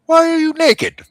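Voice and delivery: very high, startled question; shocked gnome. Acted. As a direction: gasp it out in shock. Gnome asks boy.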